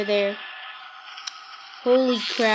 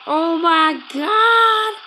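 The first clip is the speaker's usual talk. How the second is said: slow, drawn-out hyped gasp of joy; hyped fan; 3 semitones higher, 4 dB louder, much slower than usual. Retold or imitated. Remembering the trailer, a slow drawn-out thrilled cry. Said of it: "I almost screamed my head off".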